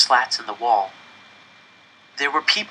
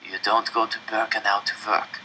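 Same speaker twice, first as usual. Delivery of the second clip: hoarse and dry; 7 dB quieter than usual. A narrator's reading. Old man beside him explaining, hoarse and dry. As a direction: rasp it out grimly. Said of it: "his voice hoarse and dry".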